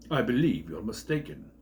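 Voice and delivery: lightly voiced, cool English professor; dry Professor reply. Professor coolly answering Rickman, lightly voiced. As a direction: calmly correct him, a touch haughty.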